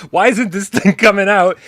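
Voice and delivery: mock-frustrated presenter; mock frustration. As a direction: play her struggling with the box. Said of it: "she also goes".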